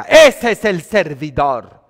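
emphatic sermon declaration; loud, slow preaching proclamation. Acted. Preaching at full force.

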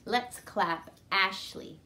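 usual speaking voice

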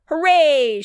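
high, loud, drawn-out cheer; excited shout. A narrator's reading. Grace races to the farm, shouting excitedly. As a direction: shout it like a thrilled child. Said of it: "shouted Grace".

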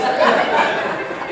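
high, loud burst of laughter; real laughter. Real, not acted. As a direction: let the laugh out fully. Laughing at own story.

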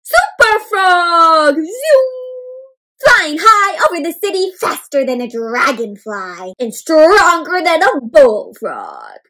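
loud, stretched-out superhero announcement; excited hero cry. A narrator's reading. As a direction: proclaim it high, big and slow. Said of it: "cried Froggy".

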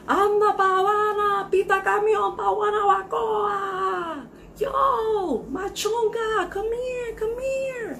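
high, tearful joyful shrieks; crying with joy. Acted. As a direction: cry out in joy. Ecstatic winner crying out.

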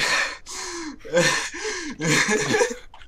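long squealing laughter; high helpless laugh. Real, not acted. Gamer cracking up after friend's ice mishap, high helpless laugh. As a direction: burst back into a laughing fit. Laughing fit breaks out anew.

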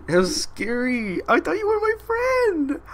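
very high, slow, hurt squeak; mock betrayed falsetto. A gamer betrayed by the flower, in a high squeak. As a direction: play wounded in a squeaky falsetto.

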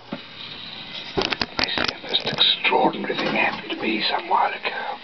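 strained voiceless whisper, drawn out; lost voice. Acted. Storyteller opening his tale finds his voice gone, voiceless whisper. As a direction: rasp it out slowly with no voice. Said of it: "Something's happened to my voice".

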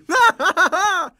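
loud high gloating laugh; taunting laugh. Acted. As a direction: laugh after dodging the hit. Boss dodges and laughs.

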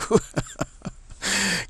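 bright burst of high laughter. Real, not acted. Laughing after mock boss quote.

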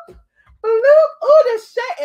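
high, delighted squeal; gleeful squeal. Real, not acted. Gleeful reaction to shade.

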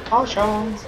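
mock awe. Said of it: "It's gonna be like".

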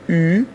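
او as a held German ü; an "ee" through rounded lips for او; rounded u-umlaut. Shown as a demonstration. Teacher demonstrates u-umlaut, او as long rounded ü.

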